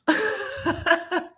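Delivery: high burst of disbelieving laughter; real laugh. Real, not acted. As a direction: laugh out at the absurd remark. Laughing at overheard remark.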